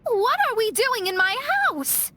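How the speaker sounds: high, baffled exclamation; can't believe where she is; pitched high in sudden disbelief; startled. Acted. Shocked to find her house.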